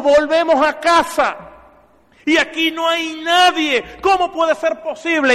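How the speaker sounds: put-on loud, high, slow, outraged old pastor; indignant complaint. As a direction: protest that nobody came to greet them. Husband complains nobody welcomed them.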